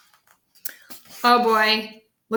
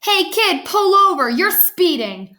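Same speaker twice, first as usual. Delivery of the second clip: high, raised-voice police order; storybook officer's yell; 8 semitones higher, 3 dB louder than usual. A narrator's reading. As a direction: call it out to a speeder, easing off at the end. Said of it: "rolled down the window and yelled".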